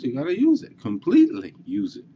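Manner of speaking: high, pressing stress on use; urgent insistence. Real, not acted. Teacher urges the kid to use every line, high and pressing. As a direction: drive the point home.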